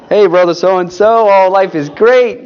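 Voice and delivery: paste on a big smile for the brother; fake cheerfulness. Churchgoer greeting a brother with fake cheer.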